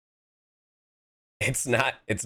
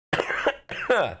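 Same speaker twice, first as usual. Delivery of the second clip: a put-on cough aimed at chat; a deliberate mock cough toward the mic; fake cough; 10 semitones higher than usual. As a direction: cough on purpose, hammed up. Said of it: "I'll cough on you".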